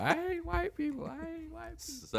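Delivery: high, slow mock shriek, fading out; mimicking screaming lady. Retelling the lady's chant, high and fading. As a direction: ham up the ranting homeless woman, fading. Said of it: "just over and over and over".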